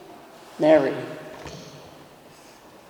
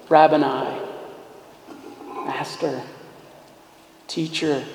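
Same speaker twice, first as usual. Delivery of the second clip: affectionate whisper; 5 semitones lower, 10 dB quieter than usual. Retold or imitated. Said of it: "this affectionate".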